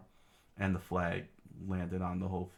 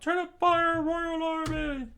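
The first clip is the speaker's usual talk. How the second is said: very high, loud falsetto order; screamed command; 6 dB louder, slower than usual. Acted. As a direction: yell it to the troops, stretching the end. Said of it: "soldiers screamed out".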